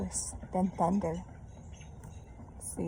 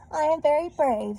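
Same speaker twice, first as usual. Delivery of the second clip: higher, louder boast; proud brave girl; 7 semitones higher, 5 dB louder than usual. A narrator's reading. Reader voicing Sheila Ray boasting, higher and louder. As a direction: pat yourself on the back out loud. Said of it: "Sheila Ray said".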